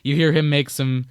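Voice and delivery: ordinary talk, nothing marked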